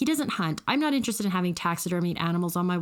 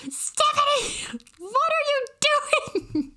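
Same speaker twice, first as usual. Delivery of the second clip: high, slow, part-whispered alarm; alarmed mom; slower than usual. Retold or imitated. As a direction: mom hissing her daughter's name in alarm. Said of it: "And she goes".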